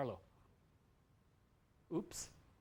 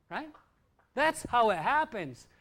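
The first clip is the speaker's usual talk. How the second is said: ordinary talk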